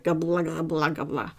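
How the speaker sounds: imitating a babbling baby; quick, nonsense baby gabble, dropping at the end; baby babble. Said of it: "I was a baby and I was like".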